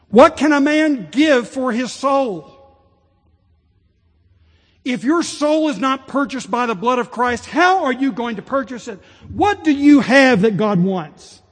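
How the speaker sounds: loud, high, ringing pulpit cry; full-force preaching. Acted. Preacher pressing the congregation on futility, loud and ringing. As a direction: drive each question home to the congregation.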